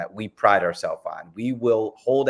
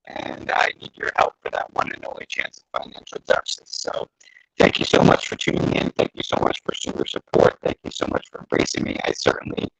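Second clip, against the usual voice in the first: muffled bad connection audio; like talking from underwater; underwater sound. Real, not acted. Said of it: "sound like we're underwater".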